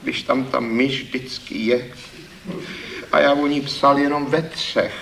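high, downcast plea with a rueful laugh; dejected. A narrator's reading. Thief excusing himself to the critic, dejected and high. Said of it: "Pravil hlas malomyslně".

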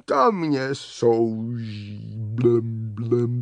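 low, slow burbling complaint; bubbling water creature. Acted. Water scorpion troubled by her cold, bubbling. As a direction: moan it low, ending in blubs.

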